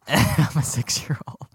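breathless whisper through laughter; whispered giggle. Real, not acted. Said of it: "I'm crying".

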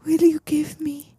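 high, slow put-on voice; mock girlfriend. Girlfriend makes boxer a request, high slow mock voice. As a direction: ask slowly in an imitation girlish pitch.

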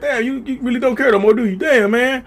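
a hurt, wounded "you really don't care"; stunned follower. Retold or imitated. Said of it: "You be like".

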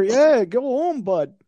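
high-pitched mock Canadian accent; bad Canadian accent. Imagined Canadian sends illegal entrant home, bad Canadian accent. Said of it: "I can't do a Canadian accent".

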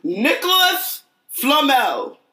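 high, slow, grand announcement; dramatic reveal. Pretend. Reading the clue to her co-host, overdramatic proclamation. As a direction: proclaim it like a movie trailer.

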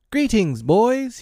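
high, bright, ringing greeting; cheerful cry. A narrator's reading. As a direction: hail them like a hero returning. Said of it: "he cried".

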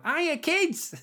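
very high, squeaky panto call; panto greeting. Acted. Comic forgetting he's not in panto, high-pitched panto voice. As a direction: greet the children in a silly high voice. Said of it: "Just come out and go".

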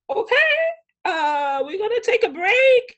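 imitated awkward photographer, high and loud; flustered photographer. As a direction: mimic someone uneasily handling tears. Retold photographer reacting to her tears.

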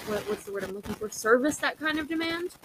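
usual speaking voice

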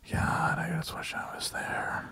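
slow breathy mock-longing whisper. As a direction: whisper it like a needy lover. Mock seductive phone sex bit.

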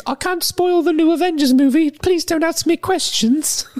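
put-on very high, slow squeaky British boy voice; a pleading little schoolboy dodging questions; Tom Holland impression. Mocking Tom Holland in interviews, squeaky slow voice. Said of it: "that's Tom Holland to me".

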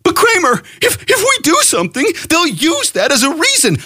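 high whiny mock voice of a timid follower; a high, whining parody of a worried supporter; mocking whiny objector. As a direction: squeak it out like a scared naysayer. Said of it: "they think that they can move forward".

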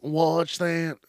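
"Watch that" in an odd, drawn voice; oddly put-on "Watch that"; odd pronunciation. Shown as a demonstration. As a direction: say "Watch that" with an exaggerated, mocking twang. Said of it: "why I said it like that".

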